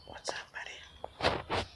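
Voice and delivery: slow hushed whisper to the cat; soft whispered hello to a nervous pet. Real, not acted. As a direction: breathe it softly so he stays calm.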